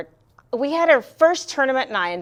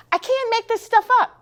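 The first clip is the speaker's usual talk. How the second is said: I can't make this stuff up, pitched much higher; emphatic exclamation; 10 semitones higher than usual. Real, not acted. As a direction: exclaim it high and insistent, almost squealing. Emphatic repeat of claim.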